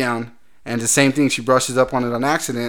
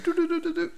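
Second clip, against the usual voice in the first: spirit fingers sound; 7 dB quieter than usual. Retold or imitated. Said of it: "she's like".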